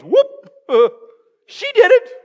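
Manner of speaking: high, loud comic squeak; mock blame-dodging. Mocking Adam passing the blame.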